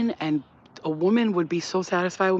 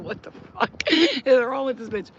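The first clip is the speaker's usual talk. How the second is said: incredulous stare; 6 semitones higher, slower than usual. Retold or imitated. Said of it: "Luna's looking at me like".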